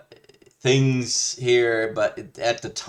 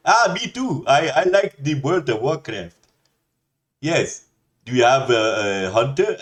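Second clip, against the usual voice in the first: put-on Indian accent; 4 semitones higher, 5 dB louder than usual. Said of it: "He'd be like".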